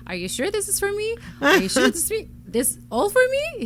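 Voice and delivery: high-pitched astonished questioning; shocked disbelief, imitated. Mother quoting her son at his surprise birthday, imitating shock. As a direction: retell it as a stunned, incredulous asker. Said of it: "He was shocked".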